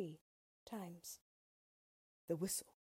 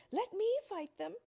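much higher, louder scarecrow cry; 14 semitones higher, 6 dB louder than usual. A narrator's reading. Scarecrow volunteering to fight crows, high eager cry. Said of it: "The Scarecrow cried".

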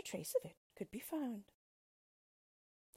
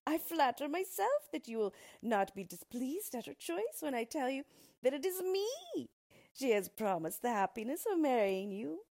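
higher, emphatic dwarf proposal; dwarf pleading his suit with relish; self-satisfied dwarf suitor; 7 semitones higher, 4 dB louder than usual. A narrator's reading. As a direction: make a grand announcement of yourself. Dwarf declares himself the groom.

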